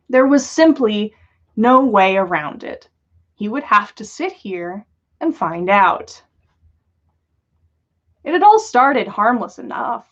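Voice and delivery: ordinary delivery, nothing marked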